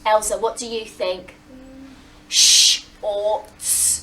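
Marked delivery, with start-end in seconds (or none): 2.3-4.0 "Sh or ts" split into slow separate sounds; robot voice sounds. Shown as a demonstration. Teacher segmenting the word for the children, slow robot sounds. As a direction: sound out "Sh or ts" slowly, one sound at a time. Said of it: "use a robot voice".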